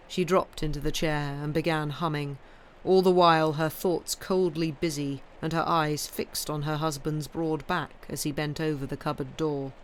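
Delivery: usual speaking voice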